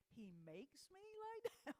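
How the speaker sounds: half-laughing mock disbelief, trailing off softly; as if you can't believe the verse says that; incredulous. Pastor balking at the verse, incredulous half-laughed question. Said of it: "I mean, hang on, hang on, God".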